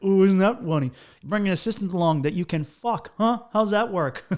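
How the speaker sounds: mock teasing. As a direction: needle him with loud mock wonder, near laughter. Retells his teasing of the guide.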